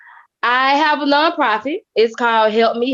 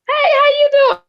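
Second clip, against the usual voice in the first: bright greeting; 4 dB louder than usual. Retold or imitated. Friends greeting her warmly.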